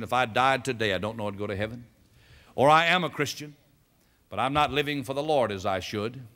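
usual speaking voice

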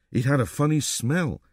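usual speaking voice

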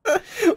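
squealing laugh near tears; high crying laugh. Real, not acted. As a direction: laugh until it hurts. Laughing at friend's giggles.

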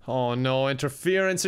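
normal talking voice

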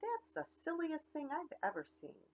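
high, scoffing thought; mock the silly duck lightly; scoffing cow. Cow thinks the biking duck is silly, high scoff.

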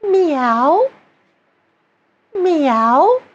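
high, gentle rising meow; smooth twang meow. Shown as a demonstration. Coach demonstrating twang, a gentle high meow. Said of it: "gently and smoothly say".

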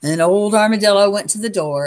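ordinary delivery, nothing marked

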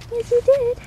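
high sing-song yes you did; dog praise voice. Real, not acted. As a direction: gush at the dog. Praising dog after treat.

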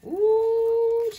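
long, loud, high-pitched "ooh"; high drawn-out "ooh". Real, not acted. Tarot reader reacting to the cards, long high "ooh".

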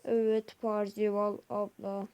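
slow robot voice. Acted. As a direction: speak like a robot. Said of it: "Robot gibi konuştuk".

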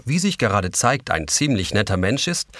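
usual speaking voice

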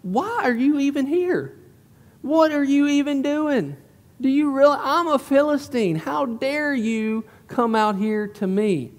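taunting giant. Acted. Preacher paraphrasing Goliath's jeer at Israel, taunting. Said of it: "You see what he's saying there?".

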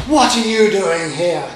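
angry father elephant trumpeting; cross trumpeting. A narrator's reading. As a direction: bellow it like a cross daddy elephant. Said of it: "looking very cross indeed".

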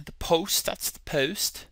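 soft put-on British accent; quiet British imitation; amateur British English, softly. Shown as a demonstration. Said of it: "give you my British accent".

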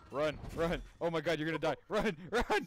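loud, high panicked yelling; being chased. Real, not acted. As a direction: yell in panic while fleeing.

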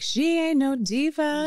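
high, drawn-out teasing imitation; War. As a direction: stretch it out in a high put-on voice. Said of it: "War, who's obviously a".

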